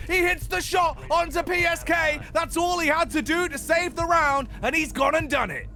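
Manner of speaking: rising, fast play-by-play to a peak; esports caster. Real, not acted. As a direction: build the hype to the clutch shot. Live play-by-play building to clutch.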